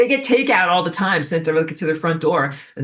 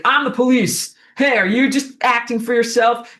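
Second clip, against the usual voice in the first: calling the suspect out; mock cop voice; 5 semitones higher than usual. Immune cell to the rogue cell, mock police bark.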